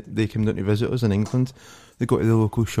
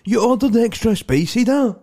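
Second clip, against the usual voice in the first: high, raised shout across the room; retold shout; 11 semitones higher, 3 dB louder than usual. Son shouting at his dad from the background, high raised shout. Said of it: "his son was shouting in the background".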